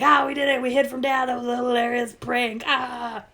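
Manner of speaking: mock little-kid triumph over the prank; gleeful kids. Two little girls cheering their prank on dad, high gleeful voice. Kids gloat after hiding.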